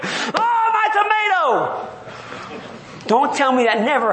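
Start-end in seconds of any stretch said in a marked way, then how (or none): 0.0-1.9 high, hammed-up wail over a tomato; mock lament. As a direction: cry out like a child mourning a toy, tongue in cheek. Mock lament over dead plant.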